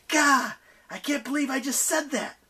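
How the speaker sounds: high, exasperated cry; exasperated outburst. A narrator's reading. Nyx horrified at asking for light, high exasperated outburst.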